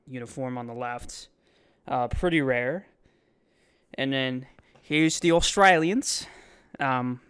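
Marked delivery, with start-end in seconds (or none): 3.9-7.2 a light, self-consciously bad Australian accent, slowed. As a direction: try an Aussie accent on the tour, slightly off. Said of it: "that accent is so bad".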